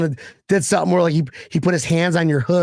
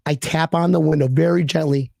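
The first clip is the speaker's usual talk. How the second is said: usual speaking voice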